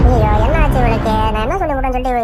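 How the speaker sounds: loud, dismayed "aiyo" of a stunned mother-in-law; shocked cry. Acted. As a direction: cry out in alarm at her rudeness. Mother-in-law shocked by rude reply.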